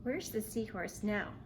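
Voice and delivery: ordinary delivery, nothing marked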